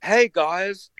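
hammed-up high-pitched VJ interviewing a band; cheesy music TV host. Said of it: "So he'd go".

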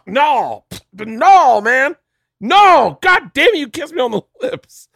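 high, loud mock outrage as Kevin; high yelling Kevin impression. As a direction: shriek it like a panicked Kevin. Kevin yelling in retold dream.

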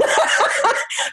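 high, breathless shrieking laugh; big laugh. Real, not acted. Guest laughing at Americans mangling her name, shrieking laugh. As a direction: burst out cackling.

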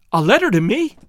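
high rising question; surprised cry. A narrator's reading. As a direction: ask in eager astonishment. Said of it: "cried the girl".

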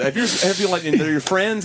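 usual speaking voice

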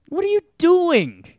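high, loud "what are you doing?"; incredulous. Retold or imitated. Telling friends about the jersey burner, loud and high in disbelief. Exasperated at drunk fan.

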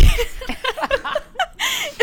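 high burst of laughter; cracking up at her own joke; big laugh. Real, not acted. Laughing hard at a friend's raspy voice.

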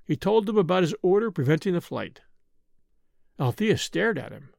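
plain reading voice

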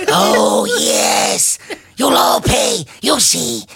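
frantic, high shouted threats; wild unhinged ranting. Acted. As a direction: rant it like a crazed elf king. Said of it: "All frantic and".

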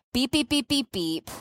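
lightly mimicked monitor beeps; monitor beeping imitation. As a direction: mimic the alarm beeps softly.

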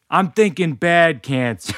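deadpan doom from dad; gloomy dad. Retold or imitated. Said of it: "My dad kicks the door closed and is like".

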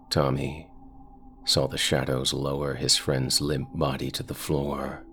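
ordinary delivery

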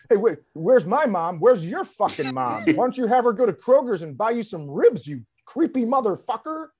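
rising, high frantic rant; freaking out. Retold or imitated. Imitating the man freaking out, high and frantic.

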